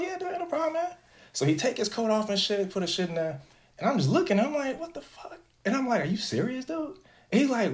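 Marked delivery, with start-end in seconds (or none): 0.0-0.9 high mock agreeable. Retells agreeing to friend. 4.8-5.4 high, breathless whisper of shock; whispered disbelief. Retold or imitated. Watching his friend stash his pajama shirt, a shocked whisper. Retells his inner reaction. 6.0-7.0 incredulous question. Retold or imitated. Retells questioning his friend.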